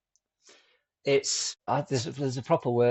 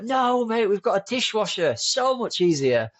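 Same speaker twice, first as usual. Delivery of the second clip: higher, bright-voiced dad setting his son straight; cheery correction; 10 semitones higher than usual. Dad cheerfully tells his son they have a dishwasher, higher and upbeat. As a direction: lift the pitch, amused and upbeat, slowing at the end.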